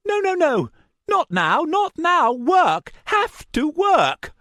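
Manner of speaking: high, loud, desperate pleading; frantic madman. A narrator's reading. Madman begging the paw to work: frantic high cries. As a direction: beg the paw like a lunatic.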